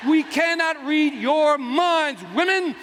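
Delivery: slow, high, hammered-out plea; mock exasperation. Man explaining male logic to women, slow exasperated plea.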